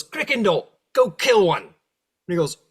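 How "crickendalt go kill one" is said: high, loud order; shouted devil order; devil command. Acted. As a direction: push the underling to kill.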